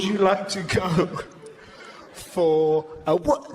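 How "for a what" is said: slow, teasing build-up; teasing slow drawl. Shown as a demonstration. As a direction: drag out for a what, slow and rising. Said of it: "Say it".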